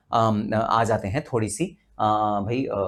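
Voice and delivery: ordinary delivery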